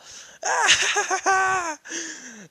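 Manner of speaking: a loud, high, maniacal cackle; loud mad cackle. Acted. Mad scientist cackling loudly after his rant. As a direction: throw back your head and cackle.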